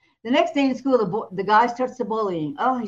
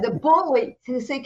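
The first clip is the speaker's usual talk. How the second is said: ordinary talk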